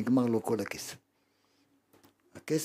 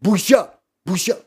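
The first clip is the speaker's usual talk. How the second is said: a touch louder, rising chant of shame; mock shouting shame; 5 semitones higher, 7 dB louder than usual. Mimicking the fools who shout shame, mildly loud and rising. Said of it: "הטיפשים האלה שצועקים".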